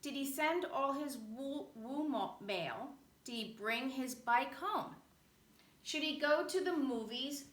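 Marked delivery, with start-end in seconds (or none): none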